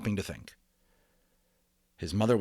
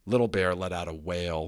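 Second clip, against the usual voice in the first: usual speaking voice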